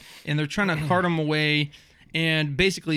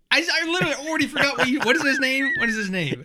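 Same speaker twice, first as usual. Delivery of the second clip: high, loud, cracking up while talking; real laughter in a high-pitched rush; laughing helplessly; 11 semitones higher, 4 dB louder, faster than usual. Real, not acted. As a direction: squeal it through real laughter. Breaks up over forgetting the name.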